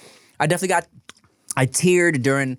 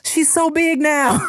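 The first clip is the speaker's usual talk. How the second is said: high, slow cooing neighbor voice; gushing neighbor; 14 semitones higher, slower than usual. Retold or imitated. Neighbor sees the growing baby, slow high coo. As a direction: coo it like a delighted stranger. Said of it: "and be like".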